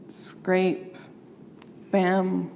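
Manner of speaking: ordinary delivery, nothing marked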